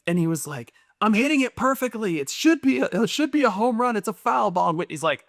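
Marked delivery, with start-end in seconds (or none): 1.0-4.7 put-on high-pitched whine of a frustrated kid; upset little boy. Son protesting to his mom over a video game, upset. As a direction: imitate a child protesting, pitched well up. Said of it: "He was getting really upset".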